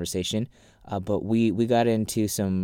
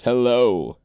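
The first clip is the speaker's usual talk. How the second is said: sexy radio voice; 2 semitones higher, 9 dB louder than usual. Pretend. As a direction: croon it like a late-night DJ. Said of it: "my sexy radio voice".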